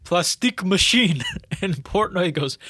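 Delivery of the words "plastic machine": lightly mimicking a foreign accent, plastique with a long ee; mock foreign accent on Plastique machine, plas-TEEK; a hint of a foreign accent on Plastique, said plas-TEEK. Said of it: "he says it in an accent".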